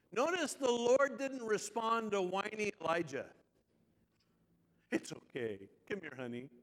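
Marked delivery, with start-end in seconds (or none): 4.9-6.5 low, soft coddling voice; soothing. Acted. Imitating a coddling reply to whiny Elijah, soothing. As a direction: comfort them tenderly, voice dropped low.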